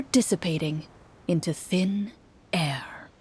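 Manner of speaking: narration fading into a whisper. A narrator's reading. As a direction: let the words vanish like the monsters. Monsters vanish, narration fades.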